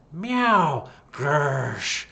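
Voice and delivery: high, loud meow and hiss; horrible cat noises. Acted. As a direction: make a strange night-cat yowl. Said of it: "strange, horrible noises".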